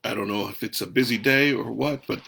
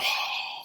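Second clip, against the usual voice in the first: soft unvoiced sigh out; remembered exercise sigh; flowing sigh; 5 dB quieter than usual. Shown as a demonstration. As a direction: let the air flow out in a sigh. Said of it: "letting it just flow out".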